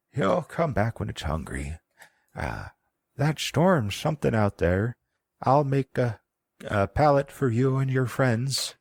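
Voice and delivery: put-on high, creaky old crone rambling; old woman voice. GM voicing the old woman to the players, high and frail.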